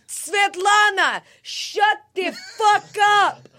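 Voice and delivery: slow, high, loud barked order; mock yelling dad. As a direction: yell it like the fed-up father. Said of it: "he'll be like".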